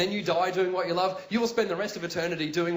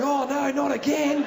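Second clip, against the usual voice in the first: Steve Irwin; 7 semitones higher, 5 dB louder, slower than usual. Pretend. Irwin waking next to wombat.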